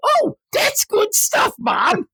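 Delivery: put-on very high, drawn-out childish praise; hammed-up kid relish, slow and squeaky; delighted kid. Kid praising Mom's dinner, high drawn-out glee.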